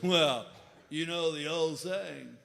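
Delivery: slow, low stoner voice; pot store clerk. Retold or imitated. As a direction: draw it out like a mellow budtender.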